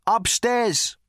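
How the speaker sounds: high, projected answer; booming thunder god. A narrator's reading.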